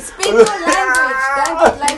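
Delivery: wag a finger and scold them, loud and hammed-up; mock scolding. South African scolding the English for lazy speech, loud mock telling-off.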